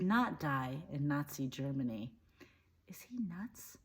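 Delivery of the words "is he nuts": hushed, unvoiced-sounding disbelief; very soft appalled whisper; whispered aside. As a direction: whisper it behind his back. Shocked aside about the drunk German.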